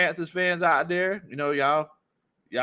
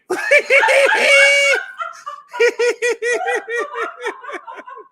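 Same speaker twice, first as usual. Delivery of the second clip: loud, high burst of laughter; big laugh; 8 dB louder than usual. Real, not acted. Host cracking up at a chat comment, loud howling laugh.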